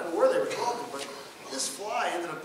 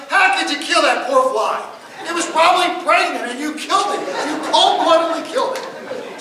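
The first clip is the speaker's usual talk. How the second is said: mock outraged activist; 9 semitones higher, 16 dB louder than usual. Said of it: "They sent telegrams, emails".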